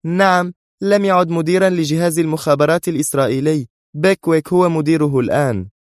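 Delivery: high, emphatic correction; interrupting. A narrator's reading. Sonia interrupts the man with the news, insistent. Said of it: "قاطعته مرة أخرى".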